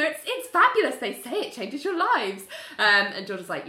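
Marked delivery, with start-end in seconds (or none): 0.0-2.4 forced enthusiasm. Retold or imitated. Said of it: "like, you know".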